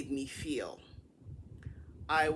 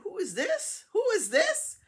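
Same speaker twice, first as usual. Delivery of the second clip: much higher, rising and getting louder; insistent confused question; 4 dB louder than usual. Retold or imitated. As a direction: demand to know who is calling. Acts out confused, insistent repeated question.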